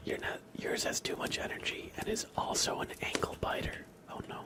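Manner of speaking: ASMR whisper. Real, not acted. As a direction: whisper close to the mic, unhurried.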